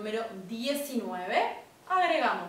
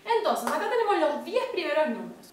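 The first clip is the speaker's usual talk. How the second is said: ordinary talk, nothing marked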